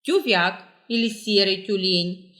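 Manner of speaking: ordinary talk, nothing marked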